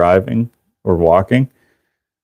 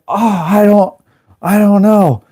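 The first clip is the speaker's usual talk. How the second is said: high, stammered mock bewilderment; flustered denial; 11 semitones higher than usual. Culprit answering the crowd, flustered. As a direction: act clueless in a pitched-up panic.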